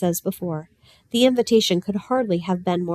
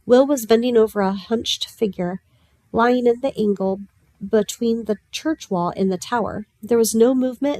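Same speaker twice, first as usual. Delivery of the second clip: ordinary delivery, nothing marked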